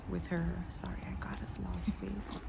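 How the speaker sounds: soft whispered aside; whispering. Real, not acted. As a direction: whisper it behind her back.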